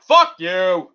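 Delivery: loud put-on shout; mock shout. Answering imagined Russian hate mail, a loud mock shout. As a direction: fire back at the haters.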